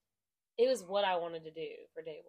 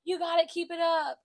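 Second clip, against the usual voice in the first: cheer coach encouragement; 8 semitones higher, 7 dB louder than usual. Retold or imitated. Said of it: "I might be like".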